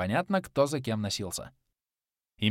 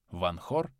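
ordinary delivery, nothing marked

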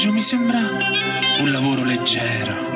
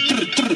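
quick rattling "trr, trr" of an air wrench; imitated wrench noise. Worker mimes the automatic air wrench at work, imitated whirr. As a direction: mimic the pneumatic wrench in two short bursts.

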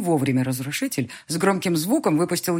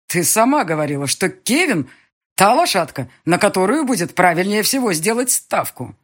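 indignant; 3 semitones higher, 7 dB louder than usual. Acted. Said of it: "Галь возмутился".

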